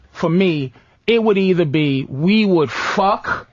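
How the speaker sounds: ordinary talk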